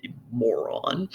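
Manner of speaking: low, soft, halting put-down; sarcastic contempt. Acted. What sarcastic pity really means, quiet contempt. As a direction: mutter the insult low and slow. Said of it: "in sarcasm".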